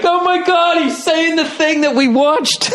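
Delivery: fanboy excitement. Retold or imitated. Friends at a concert, thrilled and amazed. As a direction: squeal it like a starstruck fan. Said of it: "looked at each other like".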